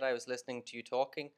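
usual speaking voice

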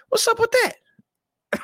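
very high, slow, drawn-out squeal; mock falsetto. Asking about Alex Jones in a very high, slow mock voice. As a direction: stretch it high and slow like a comedy bit. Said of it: "repeated in mock voice".

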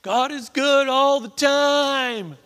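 high, drawn-out ringing shout; loud declaration. Real, not acted. Preacher calling out to the congregation, loud declaration. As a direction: proclaim it slowly at full voice.

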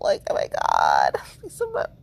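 mock sob. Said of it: "and I'm freaking out".

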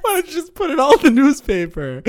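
hammed-up sarcastic jab; mock sarcasm. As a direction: needle the mother like a teen. Mock sarcastic daughter line.

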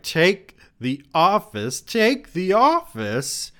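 high, loud, drawn-out command; slow, rising theatrical yell; urgent crying out. A narrator's reading. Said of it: "she cried".

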